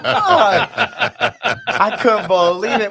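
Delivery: high, loud laughing curse; laughing dismay. Retold or imitated. Host retelling his phone dying before a flight, high and laughing. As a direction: laugh out the frustration.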